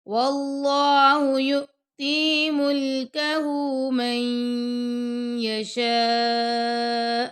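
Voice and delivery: slow, measured tajweed chant; Quran recitation. Shown as a demonstration. Teacher reciting the verse to students, slow and melodic. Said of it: "اللہ کے نام کو موٹا پڑھیں گے".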